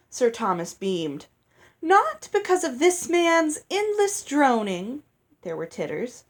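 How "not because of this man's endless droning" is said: high, loud theatrical proclamation; a fairy performing her delight; grand gushing speech. A narrator's reading. Lucinda declaiming to the wedding hall, high and theatrical.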